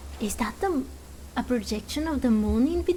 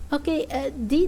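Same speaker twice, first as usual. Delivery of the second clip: normal talking voice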